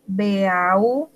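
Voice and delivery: usual speaking voice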